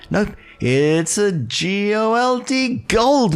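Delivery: high, slow spelled-out GOLD; excited reveal. Acted. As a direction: savor each letter with glee.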